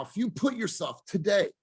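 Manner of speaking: normal talking voice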